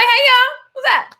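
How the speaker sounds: a bright overeager call to the whole band; retold big friendly hey y'all; bubbly greeting. Greets band again, bigger.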